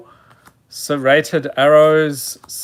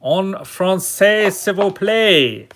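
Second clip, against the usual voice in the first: higher, slow mock French accent; 4 semitones higher than usual. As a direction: put on a terrible French accent. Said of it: "that is my French accent".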